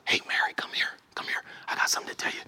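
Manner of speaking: secret whisper to her sister. Acted. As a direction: whisper it so the crowd can't hear. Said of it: "whispers to her".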